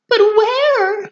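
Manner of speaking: very high, loud shriek; mother shrieking where her daughter went. Acted. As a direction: shriek it in panic. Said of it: "shrieked Missus Salt".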